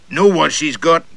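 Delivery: a pompous gentleman sounding off at the next table; loud Southern gentleman. Acted. Gentleman at the next table to his companion, blustering high and loud.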